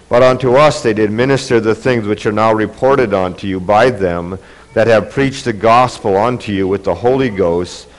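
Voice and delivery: usual speaking voice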